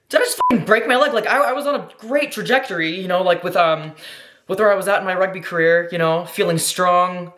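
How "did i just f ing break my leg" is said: high, quick panicked question; shocked disbelief. Retold or imitated. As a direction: replay the moment of realizing the leg broke. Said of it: "'cause it's like".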